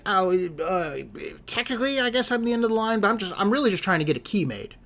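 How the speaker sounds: put-on high, hesitant old lady voice.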